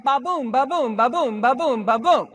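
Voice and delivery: five "ba-boom" beats, BOOM stressed each time; chanted "ba-boom" pulse, weak-STRONG; iambic rhythm. Shown as a demonstration. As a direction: drum out "ba-boom" evenly, second syllable heavy. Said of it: "take five of those".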